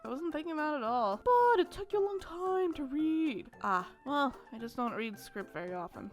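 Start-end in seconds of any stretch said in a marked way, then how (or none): 1.2-3.5 put-on gentle timid young woman, a little higher; shy girl voice.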